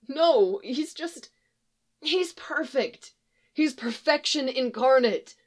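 high-pitched, halting plea; pleading young man. Acted. Young man held at gunpoint explains himself, pleading.

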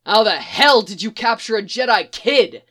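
horrified demand. A narrator's reading. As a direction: confront them in outrage. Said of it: "demands in horror".

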